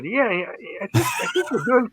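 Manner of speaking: high, airy and coy, playing it down; a sly, modest brag about being rich; coy understatement. Boasting to himself about the label's success, coyly high. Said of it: "I said".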